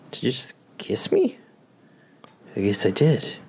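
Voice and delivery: breathless whispered question. Pretend. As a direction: whisper it in disbelief.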